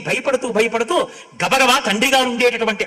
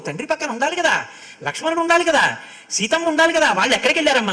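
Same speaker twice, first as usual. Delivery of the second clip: climbing higher with each anxious question; agitated pleading; 6 semitones higher, faster than usual. Acted. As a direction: press her harder each time, rising in pitch. Bharata's distressed questions to mother.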